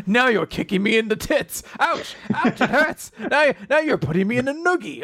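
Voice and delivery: high, whiny play-by-play of his own beating; hammed-up yelps while getting hit; mock pained self-narration.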